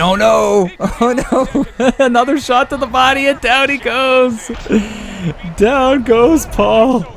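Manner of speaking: high-pitched, laughing commentary yelp; excited knockdown call. Real, not acted. Commentator calls Paul going down to the body shot, excited.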